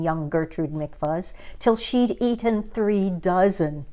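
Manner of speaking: usual speaking voice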